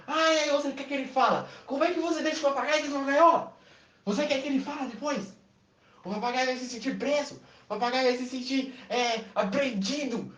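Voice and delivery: high indignant scolding; mock outrage. Salesman scolds the woman for caging the parrot.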